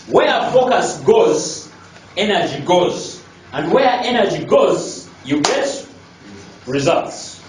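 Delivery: "Where focus goes" drawn out slowly, with "goes" held; deliberate repeat, pausing before "results" to let it land; emphatic repetition. Real, not acted. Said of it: "I think that's very important".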